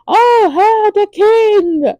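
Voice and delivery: high startled announcement; surprised exclaim. Retold or imitated. Courtier spotting the king at the door, high surprise. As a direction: react as the door swings open.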